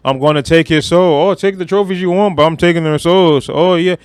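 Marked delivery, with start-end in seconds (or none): none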